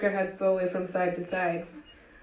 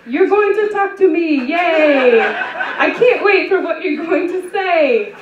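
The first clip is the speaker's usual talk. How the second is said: much higher, louder, slowing with a laugh; manic, higher, louder, slow gleeful gush; manic joy; 8 semitones higher, 8 dB louder, slower than usual. Acted. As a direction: gush with unhinged delight. Manic joy at killer's groan.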